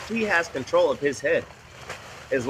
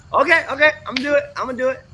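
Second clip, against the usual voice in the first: high, loud, fed-up okay okay; husband gives in to his wife's nagging; exasperated giving in; 9 semitones higher, 5 dB louder, much faster than usual. Retold or imitated. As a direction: cave in like a worn-down husband.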